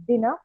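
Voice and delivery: "Dina" with an EE in the first syllable; pronounced DEE-nah. Shown as a demonstration. Lecturer gives her preferred name for the cat, "Dina" as DEE-nah. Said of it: "I like to pronounce it as".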